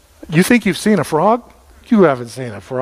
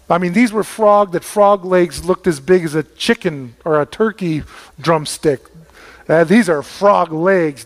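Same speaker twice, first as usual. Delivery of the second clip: ordinary delivery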